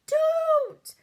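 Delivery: piercing high scream of Don't; a little girl's high, loud scream. Acted. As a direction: shriek it in terror. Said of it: "Sophie wanted to scream".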